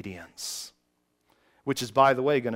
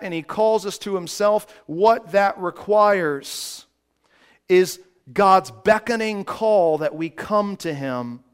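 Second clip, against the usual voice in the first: loud, high, driving preacher voice; full-force preaching; 7 semitones higher, 8 dB louder than usual. Acted. Pastor preaching to his congregation, fervent full-force preaching.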